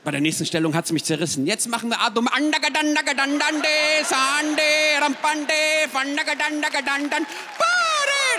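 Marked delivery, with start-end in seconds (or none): 1.5-8.4 higher, louder mock Indian chant; hammed-up accent, rising to a high shout. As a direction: rattle off fake yoga terms in an Indian accent. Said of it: "der innere Inder in mir".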